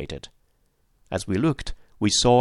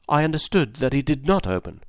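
usual speaking voice

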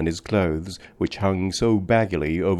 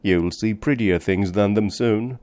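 ordinary delivery, nothing marked

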